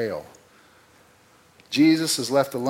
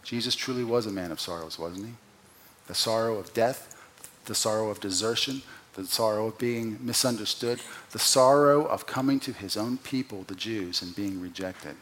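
normal talking voice

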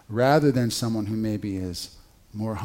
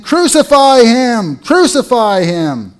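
high, loud, slow shout; crowd shouting; 10 semitones higher, 13 dB louder, slower than usual. Retold or imitated. Crowd shouting down Pilate, a loud slow roar. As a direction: bellow it like a mob. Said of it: "they kept shouting all the more".

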